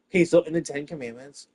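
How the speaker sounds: usual speaking voice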